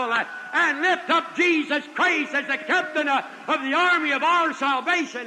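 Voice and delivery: loud, high, sung-out preaching voice; fervent gospel preaching with a sing-song swell; full-force preaching. Acted. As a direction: lift the congregation, chanting it out. Preaching climax, hallelujah.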